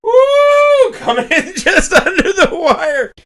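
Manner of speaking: high, loud whoop then slow laughing words; victory woo; excited whoop. Real, not acted. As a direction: cheer like you squeaked through. Cheering a lucky pick.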